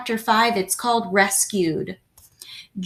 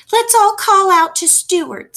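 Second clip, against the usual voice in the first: high, louder urgent suggestion; eager mother; 11 semitones higher, 5 dB louder than usual. A narrator's reading. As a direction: press the family to act. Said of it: "suggested Missus Little".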